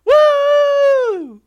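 high, booming show opener ending in a long whoop; startlingly loud welcome. Real, not acted. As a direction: burst in at full excitement. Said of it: "hope I didn't just scare you".

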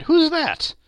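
very high, loud question; astonished cry. A narrator's reading. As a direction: call out in startled surprise. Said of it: "cried Fred".

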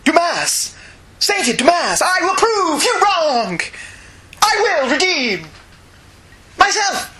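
high, slow, hammed-up vow; dramatic shout. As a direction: declare it like a fanatic hero. Acting Jean-Paul's comic reply.

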